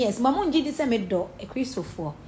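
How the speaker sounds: ordinary talk, nothing marked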